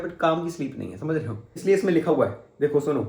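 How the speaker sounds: normal talking voice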